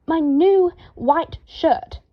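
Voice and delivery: slow, high, loud snarl. A narrator's reading. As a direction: bite out each word in fury. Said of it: "Draco snarled".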